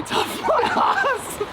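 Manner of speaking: pained laugh through the words. Real, not acted. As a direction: laugh it out in disbelief. Laughing at himself in pain.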